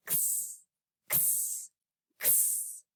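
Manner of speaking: whispered kss like knitting needles clicking; unvoiced kss, needles tapping together. Shown as a demonstration. Teacher shows the needle sound to kids, whispered kss. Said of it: "he says".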